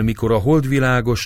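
ordinary delivery

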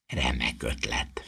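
low, soft, slow hesitant reply; quiet wavering agreement; uncertain. A narrator's reading. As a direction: answer unsurely, under your breath. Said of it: "felelte bizonytalanul Ron".

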